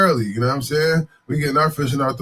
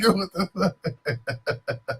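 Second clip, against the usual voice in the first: mock stunned reaction; 2 semitones higher, 5 dB quieter, much slower than usual.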